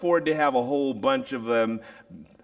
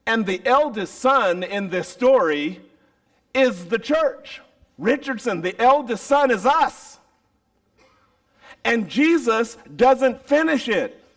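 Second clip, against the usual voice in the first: louder, higher preaching build; 10 semitones higher, 6 dB louder than usual. Acted. Preacher to congregation, full force. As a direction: drive the point home.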